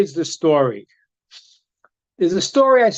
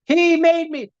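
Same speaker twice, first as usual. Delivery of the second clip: put-on whiny kid; 9 semitones higher, slower than usual. Child excuse.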